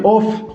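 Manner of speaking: "ऑफ" ending in a breath of F; spelling-based "ऑफ", final F unvoiced like in "off"; F as written. Shown as a demonstration. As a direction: say "ऑफ" with a plain F at the end. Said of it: "लेकिन actual में तो ऑफ".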